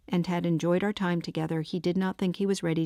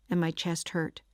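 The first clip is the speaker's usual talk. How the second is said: ordinary delivery, nothing marked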